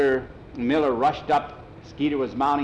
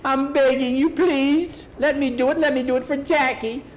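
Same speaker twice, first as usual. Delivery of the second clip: very high, louder, frantic plea; imploring at the top of the pitch range; begging; 13 semitones higher, 4 dB louder than usual. Acted. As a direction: beg like a desperate boy. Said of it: "Skeeter was begging".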